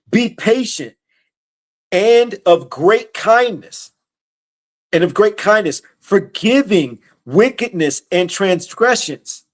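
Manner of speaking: measured preacher reading from the Bible; scripture reading. Acted. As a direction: read the verse out deliberately, stretching key words. Reads scripture aloud.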